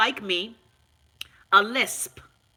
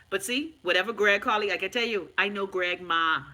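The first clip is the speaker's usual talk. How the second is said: ordinary talk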